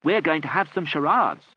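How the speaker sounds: loud and high; bawled. A narrator's reading. Mike bawling to the deaf aunt, loud. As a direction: bawl it across the room. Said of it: "bawled Mike".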